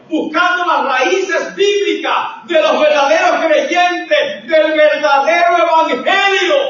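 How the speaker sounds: high, shouted preaching; fervent, loud, ringing proclamation. Real, not acted. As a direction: build the exhortation at full pulpit force.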